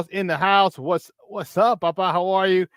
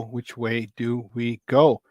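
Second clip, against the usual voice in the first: slow, drawn-out sing-song question; slow drawn repeat; 4 semitones lower, much slower than usual. Shown as a demonstration. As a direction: stretch the question out slowly.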